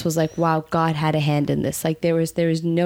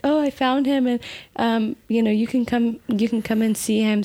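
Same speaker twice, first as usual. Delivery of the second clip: a hint of a high, chirpy front-desk voice; breezy, as if nothing went wrong; lightly voiced receptionist; 5 semitones higher than usual. Receptionist telling the wife she found him, lightly mimicked chirpy voice.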